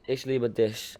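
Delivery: slow, careful "Ich liebe dich" with each word drawn out; careful German. Shown as a demonstration. Rapper slowly repeats a German phrase for his German fans. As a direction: say it slowly like a learner. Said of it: "teach you something in German".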